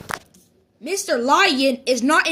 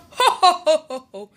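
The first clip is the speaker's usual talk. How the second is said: jolly acted laugh; faster than usual. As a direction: round ho-ho chuckle, falling. Bear laughs at the game.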